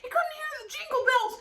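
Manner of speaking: high, loud, hammed-up indignant mom; a kid mimicking mom's indignant outrage; outraged mom impression. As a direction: imitate mom insisting it's blasphemous. Mom voiced insisting, outraged.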